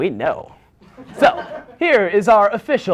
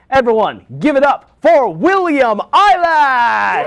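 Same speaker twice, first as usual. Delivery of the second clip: big drawn-out host announcement, higher and louder; announcer hype; 6 semitones higher, 5 dB louder, slower than usual. Acted. Host hyping the musical guest to the audience, booming announcer call. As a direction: sell the guest's entrance like a game show host.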